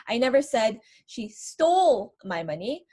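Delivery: "stole" punched loud and held; stress on STOLE. Shown as a demonstration. Teacher correcting herself mid-demo, stress on STOLE. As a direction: hit "stole" hard, questioning the verb. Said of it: "implying that she borrowed my money".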